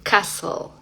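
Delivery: "castle" said as "cassel"; silent T. Shown as a demonstration. Teacher giving another example, silent T. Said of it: "silencio total".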